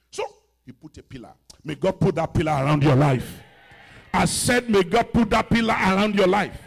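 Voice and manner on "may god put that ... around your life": very loud, forceful preacher's blessing; shouted prayer blessing. Real, not acted. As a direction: declare it over the congregation at full volume. Shouted prayer over congregation.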